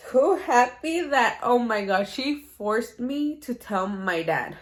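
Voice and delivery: loud, drawn-out emphasis, starting high and settling; emphatic exasperation. Real, not acted. Telling how mom forced her to tell dad, loud exasperated emphasis. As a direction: stretch it slowly and loudly.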